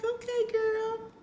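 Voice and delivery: put-on high, slow, drawn-out okay girl; lilting high mock agreement; sassy okay girl. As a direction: play it coy and knowing. Retold reply to friend.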